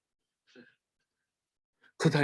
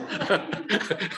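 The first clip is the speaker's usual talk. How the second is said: soft real chuckle; short amused laugh; 4 dB quieter than usual. Real, not acted. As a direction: laugh quietly at the joke. Preacher laughs at own joke.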